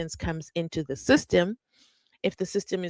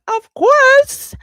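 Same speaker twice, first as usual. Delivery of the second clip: much higher, louder delighted yelp; excited squeal; 12 dB louder than usual. Real, not acted. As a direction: burst out with glee. Gushing yes to shout-out.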